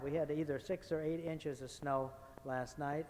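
ordinary talk, nothing marked

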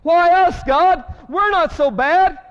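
high, loud complaining plea to God; whining plea. Retold or imitated. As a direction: whine to God that it's unfair. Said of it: "We say".

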